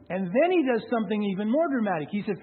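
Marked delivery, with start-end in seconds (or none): none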